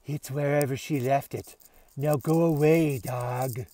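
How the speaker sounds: lightly voiced aloof cat; understated haughty cat; a hint of a bored cat. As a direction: dismiss the dog coolly. Aloof cat answers dog.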